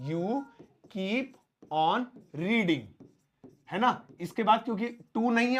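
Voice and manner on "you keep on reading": slow dictation. Shown as a demonstration. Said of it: "तो कैसे बोलेंगे".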